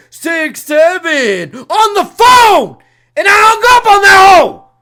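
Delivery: loud, heated rant voice; losing it at full volume; crashing out. Real, not acted. Streamer crashing out over a caller saying six seven, yelling. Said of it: "See, I crash out".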